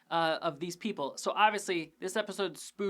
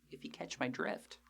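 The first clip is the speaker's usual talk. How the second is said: whispered and much softer, sly wink of a line; conspiratorial whisper; 5 semitones lower, 11 dB quieter than usual. Acted. Host hinting to listeners about the shady pastor, whispered. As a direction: lean in and whisper it like a shared secret.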